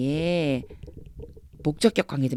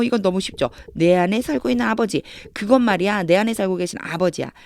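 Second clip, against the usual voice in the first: usual speaking voice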